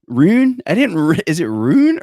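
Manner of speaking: high, puzzled "Ruin?"; questioning the word in disbelief; surprised. Real, not acted. Checking a word.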